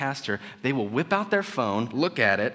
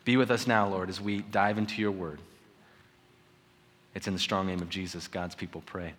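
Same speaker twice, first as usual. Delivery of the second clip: low, soft prayerful voice; 5 semitones lower, 6 dB quieter than usual. Real, not acted. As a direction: close in quiet, reverent prayer.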